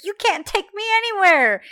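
high, loud indignant refusal; mock defiant protest. Woman to the man driving her away, high mock defiance. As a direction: defy him in hammed-up outrage.

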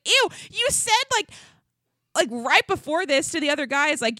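high-pitched, incredulous retold outburst; indignant disbelief. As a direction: call out his hypocrisy, voice climbing. Retelling her outraged reaction to him.

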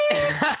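very high squeaky mock bleep; censor beep imitation. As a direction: squeal out a bleep over the words. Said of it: "Just be like".

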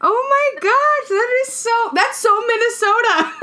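high, laughing exclamations of delight. Real, not acted. Host cracking up at the fishing trip story, laughing high. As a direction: squeal it out through the laughter.

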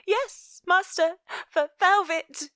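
put-on high, stammering scared little girl; timid, high-pitched child's voice; frightened child. A narrator's reading. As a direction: stumble over the master's name in fear. Frightened child answers her master.

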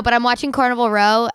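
usual speaking voice

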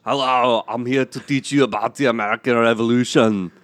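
put-on thick Austrian accent; Arnold Schwarzenegger. Guest arrives to teach kids, Austrian accent. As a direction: greet the kids like an Austrian action star. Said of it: "Arnold Schwarzenegger, come out".

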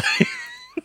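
very high short laugh; squeaky laugh. Real, not acted. Speaker laughs at their own work-voice joke, high squeak.

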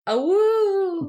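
high, loud howling cheer; wolf howl. Acted. Howling again.

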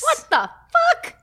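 high, laughing disbelief; stunned and amused; shocked. Real, not acted. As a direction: blurt it out high-pitched, half laughing. Shocked at the ostrich fact.

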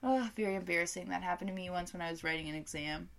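ordinary talk

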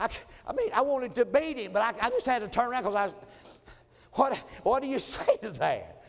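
high, loud, laughing through the words; can barely get it out for laughing. Real, not acted. Pastor recalling his son's reply to the congregation, laughing.

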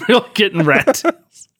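high and loud, gleeful repeat of rat tails; excited echo. Real, not acted. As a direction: echo it back high and delighted.

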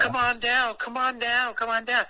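high-pitched, insistent mimicry of a woman; coaxing call. Woman luring a reluctant visitor into the basement, coaxing call.

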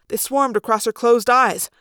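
usual speaking voice

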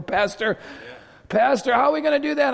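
high, loud mock panic; alarmed congregant. Congregant asking the pastor how, mock-alarmed high cry. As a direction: call out like a worried church member.

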